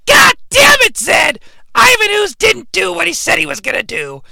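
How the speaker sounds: shrill, high screeching witch; Rita shrieking. Acted. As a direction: screech like a furious witch queen. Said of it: "Rita sees the colors fly by and she's like".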